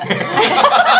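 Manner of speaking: high, loud cackling laugh; loud burst of laughter. Real, not acted. Friends cracking up at a joke, loud laughter.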